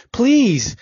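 high, loud, frightened "Please!"; kneeling friend begging at gunpoint, high and loud; desperate plea. Acted. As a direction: beg for your life. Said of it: "Trembling, he dropped to his knees".

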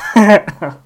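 high bright burst of laughter; gleeful laugh. Real, not acted. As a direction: laugh at your own joke. Laughs at own joke.

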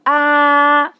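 "ah" held high and level; first tone. Shown as a demonstration. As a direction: keep "ah" high, long and even. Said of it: "speaking using that tone".

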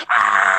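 drawn-out mock scream; imitated scream, held long; retold scream. Shown as a demonstration. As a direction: scream "ah" without full volume. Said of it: "So I'll scream like".

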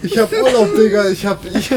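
high, loud words broken by laughter; laughing fit. Real, not acted. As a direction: try to get it out while cracking up. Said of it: "entschuldigen für den Lachflash".

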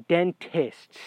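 sarcastic air quotes on "dentists"; air-quoted word. Shown as a demonstration. Patient doubting his so-called dentists, air-quoted emphasis. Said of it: "I'm saying with air quotes".

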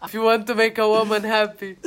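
laughing, mock Italian accent. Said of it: "sotaque horroroso da [name]". (sotaque italiano rindo)

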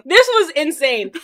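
high and loud, laughing through it; giggly disbelief. Real, not acted. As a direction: insist it happened while cracking up. Laughing while insisting it happened.

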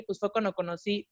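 usual speaking voice

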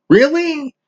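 high scoffing really?; sarcastic really. Real, not acted. Ranting vlogger scoffs at why the host was banned, sarcastic.

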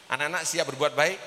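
ordinary talk

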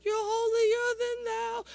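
a high, slow mock of how people see him; mock pious voice. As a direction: lilt it up like a sanctimonious churchgoer. People's view of a pastor.

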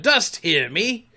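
slow, loud, high appeal; drawn-out theatrical call. A narrator's reading. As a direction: call out to an unseen spirit. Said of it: "he cried".

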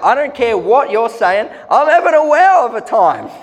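put-on loud, high, laughing carefree drunk; hammed-up happy drunk. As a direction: play a merry drunk who doesn't care. Voicing a carefree drunk person.

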